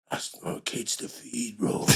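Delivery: slow whispered menace; whispered threat. Retold or imitated. The buyer threatening the narrator, whispering slowly.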